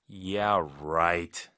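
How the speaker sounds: low, drawn-out sarcasm; skeptical, stretched "right"; sarcastic. Shown as a demonstration. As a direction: drop the pitch and doubt them. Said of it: "약간 비꼬는 톤이죠".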